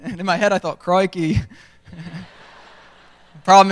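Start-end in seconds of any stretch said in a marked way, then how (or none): 0.8-1.4 inner Australian "Crikey", with the Australian vowel of "Crikey"; Australian accent. Retold or imitated. A fake Aussie thinks his Australian "Crikey". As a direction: say "Crikey" in your head like a true Australian. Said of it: "'cause I'm not Australian".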